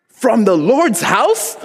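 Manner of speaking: high, drawn-out mock outrage; incredulous parent calling out, hammed up; outraged dad. Dad heard from the other room, slowly bellowing in mock outrage.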